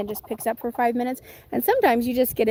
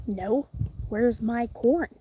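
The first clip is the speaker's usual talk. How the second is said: usual speaking voice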